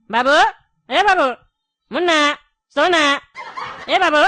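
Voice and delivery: put-on loud calls to wake a sleeping child; calling loudly. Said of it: "इतना जोर जोर से बोल रहा है".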